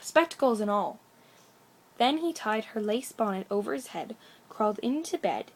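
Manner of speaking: plain reading voice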